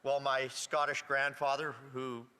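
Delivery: ordinary delivery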